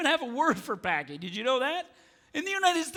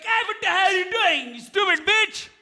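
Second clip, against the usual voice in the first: raised, high-pitched mock road rage; angry cab driver; 8 semitones higher, 4 dB louder than usual. Cabbie yelling at the Mercedes that cut him off, angry. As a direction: yell it as the furious Indian cabbie. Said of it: "this Indian guy".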